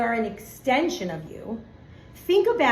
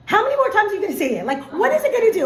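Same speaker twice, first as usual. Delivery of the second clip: fast, high, louder exasperated scolding; calling her out; 8 semitones higher, 6 dB louder, much faster than usual. Retold or imitated. Daughter calling out her mother for repeating herself, exasperated. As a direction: rattle it off loud and high, fed up with Mom. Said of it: "she called me out".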